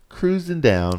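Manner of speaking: high, easy sing-out; carefree cruising. Retold or imitated. Co-host re-enacting the parasail descent, high carefree call. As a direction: lift it high, as if gliding along.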